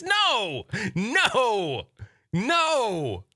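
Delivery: high, shocked no; emphatic refusal. Real, not acted. Streamer refusing a viewer's question, high and emphatic. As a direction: shoot the question down laughing. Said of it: "No, Endless".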